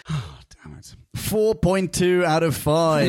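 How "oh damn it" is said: sigh, then very low and soft; muttered exasperation. Real, not acted. Host about to announce Kyle's score, muttered exasperation. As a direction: curse softly under your breath.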